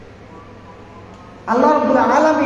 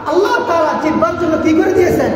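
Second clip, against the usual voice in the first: higher, ringing call to the crowd; preacher's raised cry; 6 semitones higher than usual. Real, not acted. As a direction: call the question out to the congregation.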